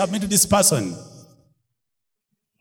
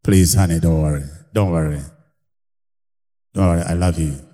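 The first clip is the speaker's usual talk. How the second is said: deep husband voice; 14 semitones lower than usual. Shown as a demonstration. Husband to wife, reassuring her in a deep voice. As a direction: drop the voice right down and soothe her. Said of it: "You make your voice a little deep".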